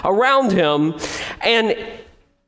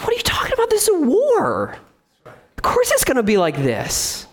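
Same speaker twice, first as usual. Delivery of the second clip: incredulous officer, high then settling; mock officer in disbelief, lightly voiced; 5 semitones higher than usual. Officer tells the complaining soldier what war means, incredulous.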